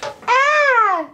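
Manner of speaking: high playful shriek of fright; mock scream. Mother pretending her child is so scary, playful scream. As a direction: scream as if startled, in play. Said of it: "I did it too soon".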